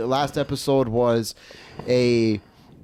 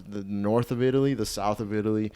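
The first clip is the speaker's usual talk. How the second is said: ordinary delivery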